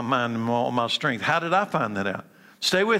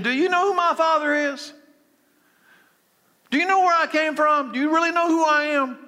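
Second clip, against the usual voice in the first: impassioned question; 10 semitones higher than usual. Acted. Preacher voicing Jesus' pressing question.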